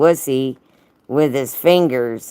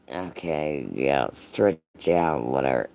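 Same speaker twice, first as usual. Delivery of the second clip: bored dismissal; 13 semitones lower, 8 dB quieter than usual. Acted. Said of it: "Then slightly louder, he said".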